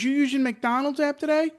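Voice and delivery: high, chirpy mock drive-thru worker; lightly voiced cashier asking; drive-thru lady. Drive-thru worker asks about the app, mock chirpy. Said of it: "and the lady goes".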